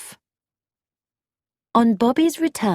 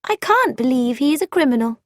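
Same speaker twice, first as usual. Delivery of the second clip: quick, higher-pitched indignant denial; heated protest; 7 semitones higher, faster than usual. Acted. Frankie defends a suspect to Bobby, heated protest. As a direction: protest it fast, with pitch raised.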